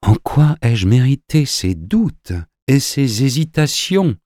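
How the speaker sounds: drawn-out, reproachful questioning; grave reproach. A narrator's reading. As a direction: question slowly and solemnly, full of reproach. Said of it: "sur un ton grave et comme empli de reproches".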